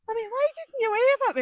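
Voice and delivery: put-on high, loud little boy's plea; child's voice. Son asking mum why she didn't wave, high child voice. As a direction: pipe up like her young son asking why.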